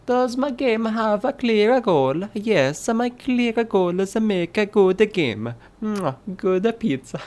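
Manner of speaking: high, hammed-up Italian accent. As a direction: read it like a comic Italian chef, Italian accent. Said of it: "This accent".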